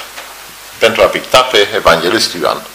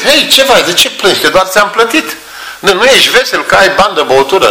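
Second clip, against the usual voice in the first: higher, puzzled painter voice; questioning painter; 8 semitones higher than usual. Retold or imitated. Michelangelo questioning the crying drunkard, higher and puzzled. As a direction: ask the weeping man what's wrong, higher.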